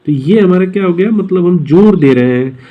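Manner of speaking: normal talking voice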